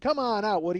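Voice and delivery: high, loud call. Retold or imitated. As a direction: call him out of the room. Friends calling Woody out.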